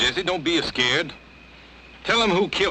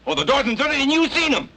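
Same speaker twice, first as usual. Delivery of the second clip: accusing; 8 semitones higher than usual. Acted. Ben accuses Jesse of murder.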